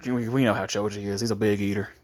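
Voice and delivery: usual speaking voice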